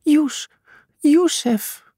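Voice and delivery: high-pitched calling of a name; restrained, high-voiced shout; narrated shout. A narrator's reading. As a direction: cry out for a child, without full volume. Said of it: "Walter schreeuwde, "Joes!" En Zaza".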